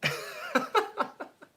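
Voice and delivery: shrill squeal of a laugh breaking out; high burst of laughter. Real, not acted. Streamer reading strange comments, bursts into high laughter.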